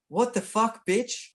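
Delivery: retold police yell. Officer yelling at the narrator during a tackle, imitated mock yell. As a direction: throw it out like the cop who burst in. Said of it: "they said".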